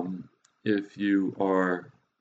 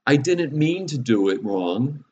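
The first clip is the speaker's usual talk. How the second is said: put-on higher, slow, drawn-out child's excuse; protesting child; 6 semitones higher, 4 dB louder, slower than usual. Father imitating his kids' excuse after repeated mistakes, protesting. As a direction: plead innocence like a caught kid, pitched up. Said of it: "I get the thing; best seven-year-old voice".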